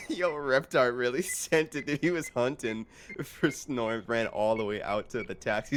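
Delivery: ordinary delivery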